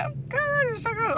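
high, laughing "oh God, so gross"; laughing disgust. Retold or imitated. Cashier recalling customer wiping nose, laughing disgust. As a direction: squeal it through a laugh, grossed out. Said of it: "I was like".

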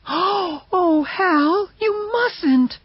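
very high, tearful plea; crying out. Acted. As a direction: beg him to stop, voice breaking high. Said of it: "she cried".

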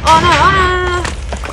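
loud, high burst of panic; high startled oh no; sudden alarm. Real, not acted. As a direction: cry out as the game goes wrong. Sudden trouble in the game.

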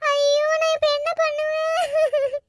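slow, drawn-out wailing lament; crying. Acted. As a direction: sob and wail over grandmother's death. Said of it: "Vasanthi, அழாத வசந்தி".

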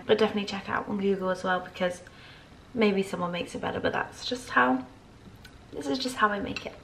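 ordinary talk

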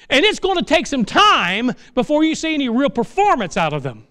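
high, loud, impassioned preaching; full-force preaching. Acted. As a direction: preach it out with fire.

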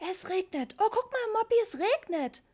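put-on high, loud little boy yelling; a small kid shrieking with excitement at the rain; child's excited shout. A narrator's reading. Little Ritchie shrieks to his brother, high and loud. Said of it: "schrie Ritchie".

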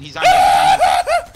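high, slow panicked yell; panicked shout. Real, not acted. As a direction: scream it out. Panicked callout, enemy attacking.

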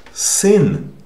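sin with hard Russian Y vowel, high and loud; example word pronunciation. Shown as a demonstration. Teacher giving an example word for the new letter, emphatic hard sin. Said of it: "In the word, for example".

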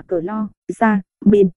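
"clo, ra, min" with pauses between syllables; syllable-by-syllable reading. Shown as a demonstration. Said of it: "chloramin đọc là". (đọc "clo, ra, min" tách từng âm tiết)

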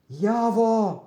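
usual speaking voice

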